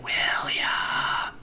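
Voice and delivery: breathy ghostly whisper of his name; eerie whisper. Retold or imitated. Dead fish whispering William's name to the boy. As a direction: whisper the name like a haunting call. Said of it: "one of the fish is calling his name".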